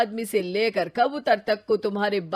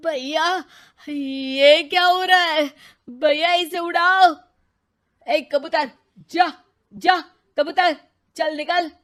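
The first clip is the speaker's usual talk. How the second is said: panicked; 7 semitones higher, 6 dB louder than usual. Acted. Pigeon lands on his head.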